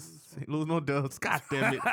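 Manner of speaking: normal talking voice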